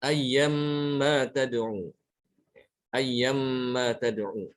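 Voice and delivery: Ayyamma tad'u drawn out slowly; slow recitation. Shown as a demonstration. Teacher recites the example twice, slow. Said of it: "Contoh ya".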